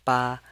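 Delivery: ba high, level and firm; first tone. Shown as a demonstration. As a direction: hold each "ba" flat up high. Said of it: "pronounce it in the first tone".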